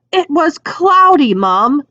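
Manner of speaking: put-on high, bright protesting teen voice; Fred's voice. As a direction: raise it into a lively teenage protest. Fred protesting to his mother.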